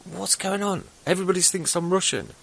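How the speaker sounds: high, puzzled exclamation; baffled retelling. Retold or imitated. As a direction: raise the voice in mock bewilderment. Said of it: "I kept saying".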